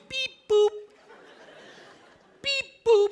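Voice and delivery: high chirpy beep boop; signal beep imitation.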